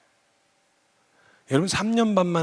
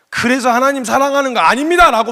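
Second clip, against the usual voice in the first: loud denial; 9 semitones higher, 10 dB louder than usual. Retold or imitated. Imagined denial to God.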